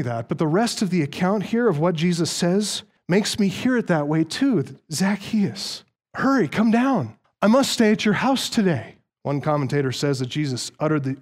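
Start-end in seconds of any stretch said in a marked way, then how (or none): 4.9-9.0 eager, higher-pitched friendly invitation; cordial, friendly. Acted. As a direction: greet a familiar friend you are glad to see. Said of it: "uttered these words in a cordial tone".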